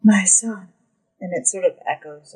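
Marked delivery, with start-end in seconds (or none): 0.0-0.7 loud, echoing voice of a fairy queen. Acted. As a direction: speak without moving your lips, like a queen. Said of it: "and she says".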